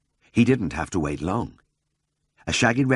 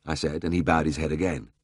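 ordinary delivery

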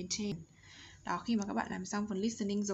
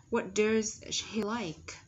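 slow, word-by-word "What does she like?"; slow model question; 3 dB louder, much slower than usual. Shown as a demonstration. Teacher introducing a question pattern to students, slow careful model.

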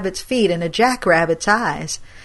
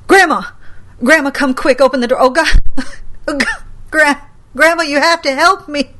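high, loud frantic calls for grandma; panicked pleading; 7 semitones higher, 7 dB louder than usual. Acted. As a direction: beg her to open up, terrified. Grandson hammering on door, pleading.

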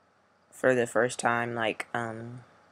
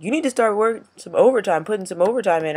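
mimicking supervisor; 7 semitones higher, 7 dB louder, faster than usual. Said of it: "And he was like".

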